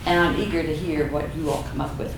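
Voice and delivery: ordinary delivery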